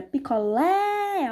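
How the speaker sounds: "picolé" with a long open final LÉ; stressed open vowel. Shown as a demonstration. Teacher contrasts an open sound, long LÉ in "picolé". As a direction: open wide and hold the LÉ of "picolé". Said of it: "eu abro muito bem a minha boca".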